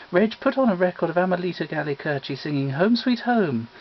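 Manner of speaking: plain reading voice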